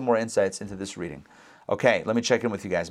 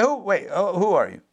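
lightly acted double-take, pitched up; suspicious; 8 semitones higher than usual. Father unsure whose voice he hears, suspicious. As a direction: break off and question, as if doubting the voice. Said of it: "He's suspicious already".

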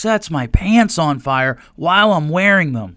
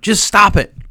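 Bob Newhart stop it; 5 semitones higher, 6 dB louder than usual. Retold or imitated. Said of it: "Bob Newhart".

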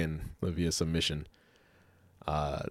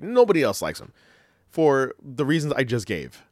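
emphatic insistence; 6 semitones higher, 6 dB louder than usual. Real, not acted. Insisting against co-host's defense.

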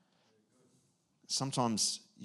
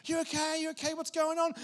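worried parent; faster than usual. Retold or imitated. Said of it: "We're like".

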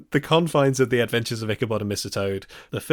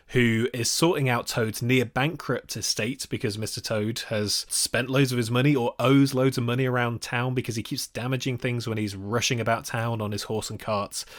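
ordinary delivery

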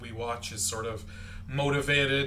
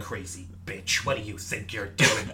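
gruff scolding voice; 5 semitones lower than usual. Acted. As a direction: growl it at her like a grumpy doctor. Hugh Laurie scolding Rachel, acted.

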